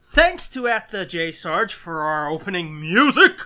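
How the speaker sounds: lightly voiced Ted Knight announcer. Real, not acted. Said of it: "I turned into Ted Knight for a second".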